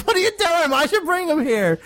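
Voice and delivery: high-pitched imitation of a flustered character; mock alarmed protest. Host retelling Goku and Kaio-sama's back and forth, mock alarmed. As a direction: squawk it high, imitating a panicked complaint.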